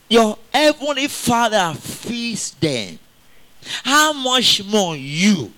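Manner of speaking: slow, solemn, drawn-out verse; solemn scripture. Acted. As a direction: let each word sink in slowly. Reading scripture, voice changed.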